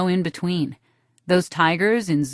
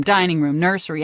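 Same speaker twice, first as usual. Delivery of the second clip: ordinary delivery, nothing marked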